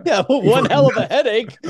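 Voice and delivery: high, loud laughing line. Real, not acted. Host laughing at the shooting story, laughing. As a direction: laugh it out in disbelief.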